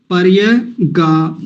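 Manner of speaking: पर्यगा drawn out slowly, syllable by syllable; slow syllable demo. Shown as a demonstration. Teacher showing recitation of पर्यगा, slowly. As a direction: spell out पर्यगा slowly by sound. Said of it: "अगला शब्द देखिए".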